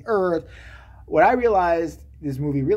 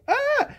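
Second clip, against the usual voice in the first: mock crowd scream.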